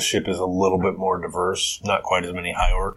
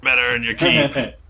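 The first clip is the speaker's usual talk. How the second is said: gruff crewman; 6 semitones higher, 4 dB louder than usual. Acted. Crewman questioning then dismissing.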